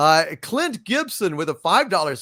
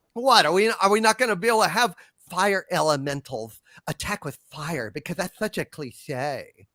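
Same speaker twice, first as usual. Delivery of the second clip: ordinary talk, nothing marked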